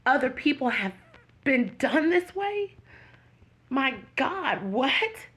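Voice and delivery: emotional disbelief, high and halting with pauses; getting emotional. Real, not acted. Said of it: "oh, I'm gonna get emotional".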